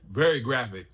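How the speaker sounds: ordinary talk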